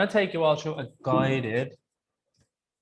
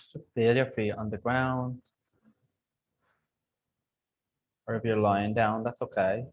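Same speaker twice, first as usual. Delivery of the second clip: calm grounding exercise. Acted. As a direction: ease them into calm breathing. Leads grounding meditation.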